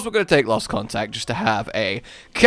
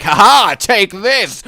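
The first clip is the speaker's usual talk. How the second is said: loud, theatrical gloating laugh and challenge; hammed-up triumphant outburst; triumphant villain entrance; 6 semitones higher, 9 dB louder, slower than usual. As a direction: announce yourself with a big mock "ha ha". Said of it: "arrive at the scene and be like".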